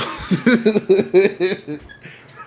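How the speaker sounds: long, loud, high laugh; bursting out laughing. Real, not acted. Storyteller losing it over his own order, real laughing fit. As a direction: crack up helplessly mid-story. Cracks up while telling the story.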